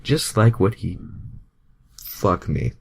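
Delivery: normal talking voice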